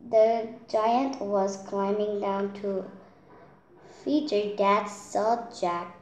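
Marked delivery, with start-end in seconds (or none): none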